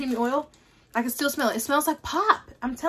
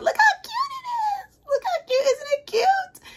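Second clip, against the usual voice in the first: very high cutesy squeal; gushing squeal; slower than usual. Real, not acted. Vlogger showing viewers a cute product, high gushing squeal. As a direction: squeal adoringly, drawing out the words.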